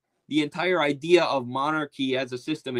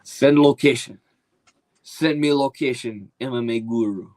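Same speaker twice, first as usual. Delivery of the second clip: Dagestani fighter impression; 4 dB louder than usual. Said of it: "the Dagestanis are perfect".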